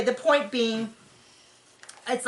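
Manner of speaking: usual speaking voice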